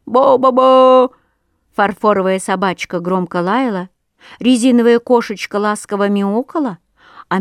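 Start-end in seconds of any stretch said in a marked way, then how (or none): 0.0-1.1 slow, loud, drawn-out bunny call; toy bunny cry. Acted. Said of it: "развеселившийся зайчик кричал".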